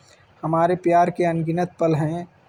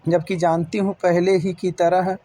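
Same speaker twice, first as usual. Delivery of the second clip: ordinary delivery, nothing marked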